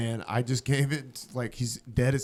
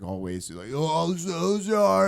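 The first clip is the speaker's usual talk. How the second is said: very slow, drawn-out, higher-pitched apology; drunken apology; 5 semitones higher, much slower than usual. Retold or imitated. Hammered man opens the door after shooting floor, drawn-out drunken apology.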